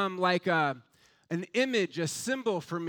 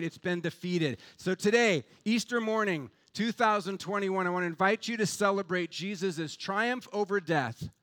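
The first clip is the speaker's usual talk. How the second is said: ordinary talk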